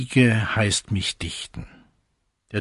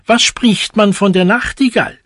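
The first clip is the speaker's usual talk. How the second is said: fast, higher and louder eager cry; higher, louder storybook shouting; shouting cuckoo; 6 semitones higher, 6 dB louder, faster than usual. A narrator's reading. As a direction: cuckoo pressing for gossip, loud and quick. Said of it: "fing er an zu schreien".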